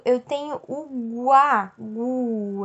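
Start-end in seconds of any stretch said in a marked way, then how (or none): 1.2-2.6 guá said with the U clearly audible, gw-a; sounding out guá. Shown as a demonstration. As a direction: isolate the syllable guá, letting the U glide into A. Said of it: "eu escuto o som do gu". (guá com o U pronunciado)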